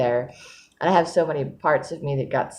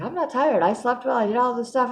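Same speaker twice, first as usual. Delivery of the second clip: lightly voiced counselor, pitched much higher; a hint of the counselor, raised high; quoting the counselor; 9 semitones higher than usual. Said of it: "She's like".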